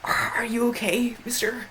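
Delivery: put-on high, whispered kid's question; timid kid whisper. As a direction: whisper it like a worried little kid. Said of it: "he's like".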